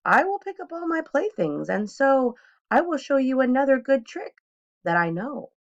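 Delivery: high, breezy Cat in the Hat; cheerful cat. A narrator's reading. Cat in the Hat calms the children, high and breezy. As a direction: reassure them grandly. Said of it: "said the Cat in the Hat".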